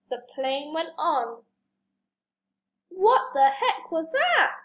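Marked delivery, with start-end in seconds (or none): 2.9-4.6 higher, startled question; mildly hammed-up disbelief; gasped astonishment. A narrator's reading. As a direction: react in storybook shock. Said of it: "gasped the woman".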